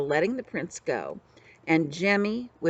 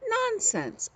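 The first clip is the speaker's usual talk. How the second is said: sharp, much higher exclamation; indignant Nonsense. A narrator's reading. Jemmy scoffs at the outlaw's guess, high and indignant. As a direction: toss it off as absurd, high. Jemmy denies being prince.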